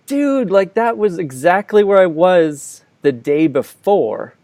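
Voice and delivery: the penny drops, spoken up high; incredulous dude, slowed down; shocked realization. Retold or imitated. Realizing he saw the killer.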